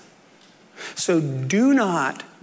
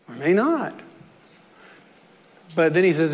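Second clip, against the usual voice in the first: ordinary delivery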